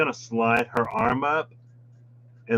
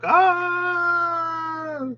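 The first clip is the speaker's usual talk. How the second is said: high yelp of alarm; startled yelp. Retold or imitated. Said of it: "This one was like".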